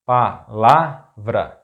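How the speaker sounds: palavra slowly split, pa - la - vra; syllable by syllable. Shown as a demonstration. Teacher breaking down an example word, palavra slow in syllables.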